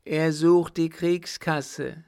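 high, loud, drawn-out warning; raving madman. Acted. Mad son warning of the war chest, raving. Madman raves at his father.

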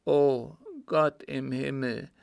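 measured, heavy-hearted dread; tormented dying father. Acted. Father telling his son of God's judgement, tormented. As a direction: refuse comfort, fearing the judgement.

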